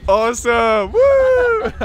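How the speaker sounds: high, loud whoop of delight; excited cheer. Real, not acted. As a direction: whoop it up with a laugh. Cheering the band's joke.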